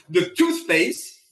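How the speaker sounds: laughing at himself; chuckling through the words; self-mocking laugh. Real, not acted. Laughing at own fussiness.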